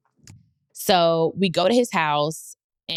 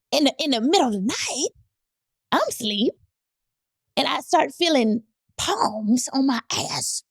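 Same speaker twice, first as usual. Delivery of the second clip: teasing suspense; 7 semitones higher than usual. Real, not acted. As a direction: draw it out for the scandal. Building to a juicy reveal.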